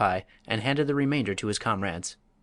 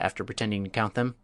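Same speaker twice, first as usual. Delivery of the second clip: ordinary delivery, nothing marked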